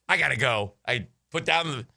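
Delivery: ordinary delivery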